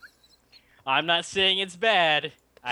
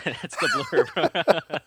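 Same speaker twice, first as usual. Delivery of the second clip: real laugh breaking into the words; 5 semitones higher, much slower than usual. Real, not acted. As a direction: say it while cracking up at the blurb. Laughing at a harsh review blurb.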